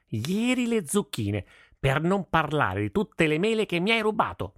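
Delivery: put-on an old peasant fuming over his ruined vegetables; angry old farmer. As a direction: toothless old farmer boiling over with anger. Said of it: "la cui ira era così straripante".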